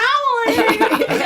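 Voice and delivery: loud high laugh; burst of laughter. Real, not acted. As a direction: crack up laughing. Laughing at the old man voice.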